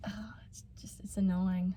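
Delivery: breathy annoyed whisper. Real, not acted. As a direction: mutter it under your breath. Annoyed aside about sick boyfriends.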